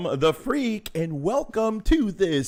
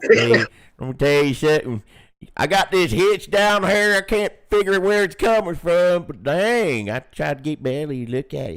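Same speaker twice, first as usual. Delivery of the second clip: hillbilly drawl. Pretend. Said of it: "You kinda sound like my cousin Bubba".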